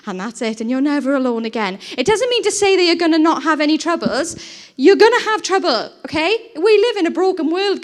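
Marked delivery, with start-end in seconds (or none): 2.0-7.8 preaching at full force. Acted. Preaching rises.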